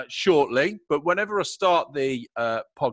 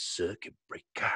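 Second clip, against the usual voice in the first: mocking fancy words; 7 semitones lower, 8 dB quieter than usual. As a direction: say "circuit breaker" softly, mocking the jargon.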